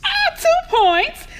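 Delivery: high, drawn-out game-show cheer; playful scoring. Acted. Mother teasing her son over the radio quiz, high playful cheer. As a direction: award the points like a quiz host.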